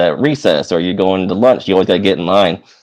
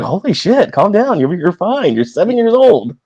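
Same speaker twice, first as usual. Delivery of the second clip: a hint of a startled parent, high-pitched; lightly voiced parent, high and soothing; parents calming; 11 semitones higher than usual. Parents calming crying child.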